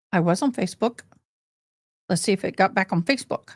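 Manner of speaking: normal talking voice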